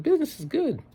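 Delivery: very high, sing-song friendly greeting; cheery store clerk. Retold or imitated. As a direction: chirp it like an overly cheerful clerk. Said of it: "He said".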